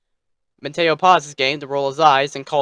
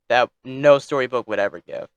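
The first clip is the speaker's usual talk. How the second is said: ordinary delivery, nothing marked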